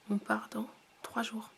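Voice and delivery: hushed unvoiced whisper. Real, not acted. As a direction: whisper it, voice nearly gone. Whispering, sick voice.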